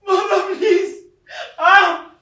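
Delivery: anguished wail. Acted. Son wailing over his mother's body, anguished cry. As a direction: let the grief burst out in a shout.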